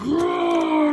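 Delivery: high, long cartoon roar; playful squealed roar; mock monster roar. Monster in a toy battle, high mock roar.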